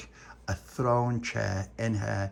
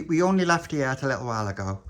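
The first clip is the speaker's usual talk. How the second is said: ordinary delivery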